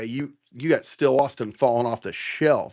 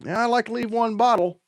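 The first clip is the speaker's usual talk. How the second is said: lightly voiced big man, pitched up; a quick mock of the big man, higher; imitating the big man; 8 semitones higher, faster than usual. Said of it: "I know the big man is".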